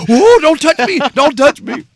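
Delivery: very high, loud laughing panic; mock scared squeal. People fleeing dad's electric handshake, squealing high and loud. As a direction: shriek away in mock fright.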